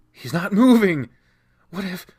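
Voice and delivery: high, loud panicked cry; panicked shout. Acted. Panicked friend finds body.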